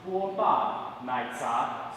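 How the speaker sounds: "guo ba nai za" drawn out syllable by syllable; slow pronunciation demo. Shown as a demonstration. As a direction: teach "guo ba nai za" very slowly and clearly. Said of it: "It's pronounced".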